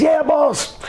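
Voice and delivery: high-pitched imitation of an obedient reply; answering the boss. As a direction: pipe up eagerly in a high voice. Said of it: "He says".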